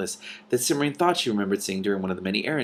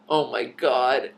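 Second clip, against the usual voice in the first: stifled laughter; 4 semitones higher, much slower than usual. Real, not acted. Reader cracks up at misread word.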